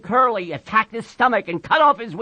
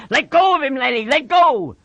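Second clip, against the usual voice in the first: high, pressing command; alarmed yell, pitched up; urgent shouting; 6 semitones higher, 4 dB louder than usual. A narrator's reading. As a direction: call out to break up the fight. George runs to stop the fight.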